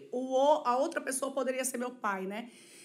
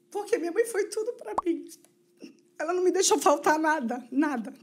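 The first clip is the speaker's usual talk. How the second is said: close to tears; 3 semitones higher than usual. Real, not acted. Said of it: "Aí eu vou chorar".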